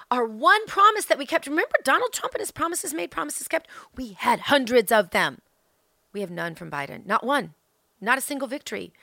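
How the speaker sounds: usual speaking voice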